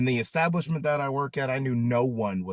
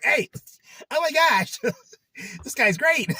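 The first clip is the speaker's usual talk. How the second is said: mock fan gush; 5 dB louder than usual. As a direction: pretend viewer, much higher and louder, laughing. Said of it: "somebody could be like".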